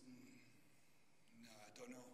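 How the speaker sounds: a quiet farmer at his gate; farmer's reply. Retold or imitated. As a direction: mumble it like a farmer who won't be bothered. Taciturn farmer in joke.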